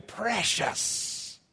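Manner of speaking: high, breathy falling wail; Gollum's last wail. Acted. Gollum falling into the fire, last wail. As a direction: wail as he falls into the depths. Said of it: "came his last wail".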